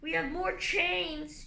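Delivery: slow, loud threatening yell. A narrator's reading. Guardians threatening Tsunami, yelling in her ear. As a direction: shout it right in her ear. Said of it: "yelled in her ear".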